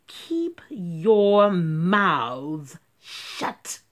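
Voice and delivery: very slow, loud menacing teacher; stern command. Acted. Strict teacher silencing the class. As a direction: drag the order out menacingly. Miss Swamp orders.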